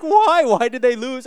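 high, loud mock wail; hammed-up distraught kid's protest; crying child. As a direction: imitate an upset little boy, high and loud. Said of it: "And he starts crying".

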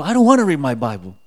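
loud, quick retold refusal; mock stubborn refusal, rushed; acted protest. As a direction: blurt out a balky objection. Arguing back at inner voice.

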